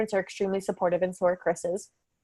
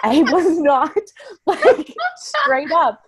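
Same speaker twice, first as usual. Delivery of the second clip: laughing; 9 semitones higher, 4 dB louder, much slower than usual. Real, not acted. Laughing about not saying it.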